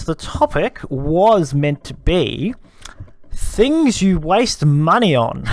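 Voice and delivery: very slow, deliberate topic reveal; drawn-out announcement. Real, not acted. Host reveals the intended topic to co-host, drawn out. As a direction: stretch each word out as you announce it.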